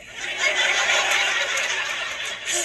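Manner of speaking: breathy high-pitched laugh. Real, not acted. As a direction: crack up at the punchline. Comic laughs at own joke.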